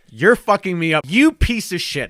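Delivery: loud, high mock rage; mock angry. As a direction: yell it in fake fury. Mock rant at spelling.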